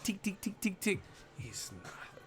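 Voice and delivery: quiet mocking imitation; Golovkin taunt. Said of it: "the most disrespectful thing Triple G said".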